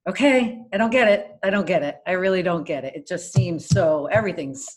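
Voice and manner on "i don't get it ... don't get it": usual speaking voice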